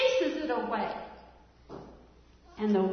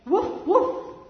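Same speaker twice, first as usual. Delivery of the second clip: loud, high woof call; shepherd's warning call; 6 semitones higher, 7 dB louder than usual. Shown as a demonstration. Teacher showing kids the wolf warning call. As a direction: bark the alarm to bunch the sheep. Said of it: "Say it with me".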